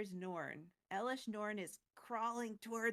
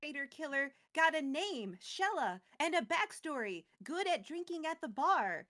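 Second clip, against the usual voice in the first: excited; 5 semitones higher, 6 dB louder than usual. Real, not acted. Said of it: "excited recap, laughing".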